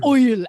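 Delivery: "Oil" with a loud, overdone "oi"; mock the tutorial's stage-Irish "Oil"; bad Irish "oi". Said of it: "she keeps saying the word oil".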